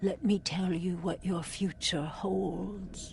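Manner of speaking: breathy mysterious whisper. Acted. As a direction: lean in and whisper the prophecy. Fortune teller's ominous offer.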